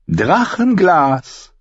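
high, drawn-out exclamation; amused red woman. Acted. Red woman reacts to Sam's dagger, high and amused. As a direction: relish the word, high and slow.

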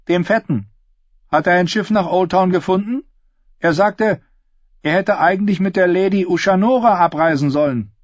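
put-on high-pitched girl character pressing for news; inquisitive Cat. Voices Cat questioning Darion. (hohe, nachhakende Stimme des Mädchens Cat)

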